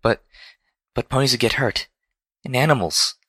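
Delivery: high, meek and halting; fearful stammer. A narrator's reading. Fluttershy frightened for ponies and animals, fearful stammer. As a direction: worry aloud in a small high voice. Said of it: "Fluttershy was trembling just at the thought".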